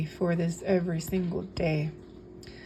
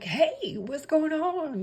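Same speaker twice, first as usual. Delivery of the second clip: friendly greeting; 8 semitones higher, slower than usual. Retold or imitated. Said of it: "here I was just like".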